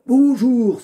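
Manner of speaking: put-on high old man's greeting. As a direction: greet like a grumpy old father. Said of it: "une voix un peu vieux".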